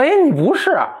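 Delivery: high startled realisation; mock surprise. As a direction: catch on suddenly. Prince realises mistake.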